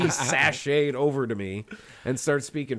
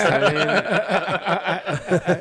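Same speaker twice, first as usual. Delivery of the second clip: laughing; 8 semitones higher, 9 dB louder, much slower than usual. Real, not acted. Laughing through joke.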